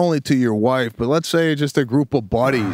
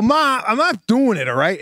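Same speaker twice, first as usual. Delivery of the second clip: higher-pitched whiny refusal to mom; protesting kid; 9 semitones higher than usual. Retold or imitated. Kid refusing his mother's piano request, protesting. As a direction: push back at mom like a pestered kid.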